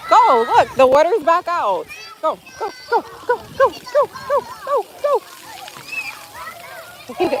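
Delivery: eager urging. Real, not acted. Mom excitedly urges her daughter back into the splash pad, high and eager. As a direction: cheer her on toward the water, pitch up.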